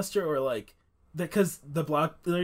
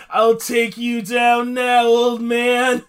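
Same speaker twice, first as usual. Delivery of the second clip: slow, loud, raised-pitch hero threat; hammed-up superhero challenge; Nightwing; 6 semitones higher, 10 dB louder, much slower than usual. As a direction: declare it like a melodramatic vigilante. Voicing Nightwing confronting Blockbuster store.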